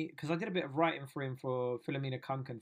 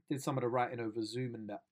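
ordinary talk, nothing marked

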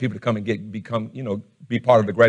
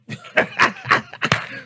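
high laugh. Real, not acted. Pastor laughing at his own prayer, high laugh. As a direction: burst of high breathy laughter.

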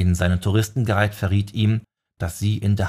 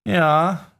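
theatralischer Seufzer; 9 semitones higher, 3 dB louder than usual. A narrator's reading. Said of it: "seufzte Leroy theatralisch".

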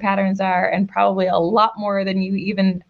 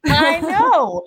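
high, slow, knowing "uh-huh"; high drawn-out smug reply to her husband; smug told-you-so; 7 semitones higher, much slower than usual. Retold or imitated. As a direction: draw it out like you knew all along.

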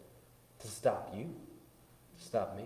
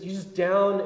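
ordinary talk

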